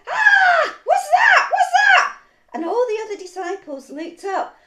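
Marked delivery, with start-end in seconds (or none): 0.1-2.2 very high, loud frightened shriek; terrified scream. Acted. Disciple spotting a figure on the stormy water, screaming. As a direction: scream out in sudden alarm. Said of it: "all of a sudden he screamed".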